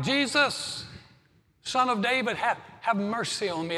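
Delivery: the blind beggar crying out to Jesus for mercy, loud and high. Retold or imitated. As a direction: beg loudly for mercy, voice straining up. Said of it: "he began to cry out".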